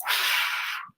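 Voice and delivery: demonstrated big breath in and out; sharp inhale, then blown-out breath; rescue breath. Shown as a demonstration. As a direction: take a deep audible rescue breath.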